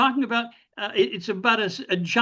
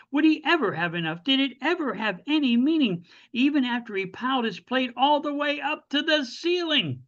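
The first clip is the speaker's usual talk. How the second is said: higher, rhythmic storytelling poem voice; poem recital; 4 semitones higher than usual. Acted. Poet reads to online audience about a greedy boy, poem recital. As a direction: recite it in rolling, emphatic rhymes.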